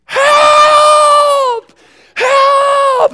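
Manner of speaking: very high, loud desperate scream; screamed for help. Retold or imitated. Panicking girl screams for help, very high and loud. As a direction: shriek it like a panicking child. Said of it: "and she screamed".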